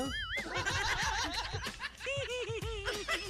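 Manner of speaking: long, high laugh; high giggling laugh. Real, not acted. DJ laughing at her own impression. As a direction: crack up.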